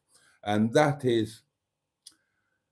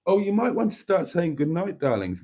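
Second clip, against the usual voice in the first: usual speaking voice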